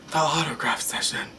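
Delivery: hushed, breathy whisper of awe; awed, voiceless repeat; dramatic whisper. Real, not acted. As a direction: whisper it like a big reveal.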